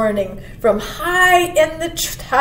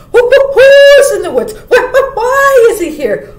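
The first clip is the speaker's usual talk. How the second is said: high, loud hooted questions; questioning owl; 13 semitones higher, 10 dB louder than usual. A narrator's reading. Owl asking who, why and when about the stranger, hooting high and loud. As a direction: hoot out each question word, stuttering. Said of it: "asked the owl of many questions".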